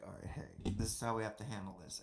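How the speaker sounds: low, quiet, offhand retelling of a colleague; Kat. Retold or imitated. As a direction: quote the instruction low and quickly, under your breath. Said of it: "Kat was like".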